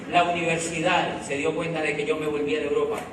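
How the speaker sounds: ordinary talk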